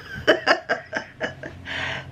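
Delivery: real wheezy, voiceless laugh. Real, not acted. Amused by his lordship's request, laughing. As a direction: laugh helplessly under your breath.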